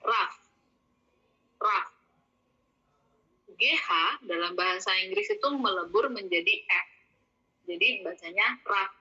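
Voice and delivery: ordinary talk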